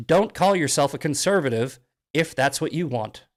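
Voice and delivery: ordinary delivery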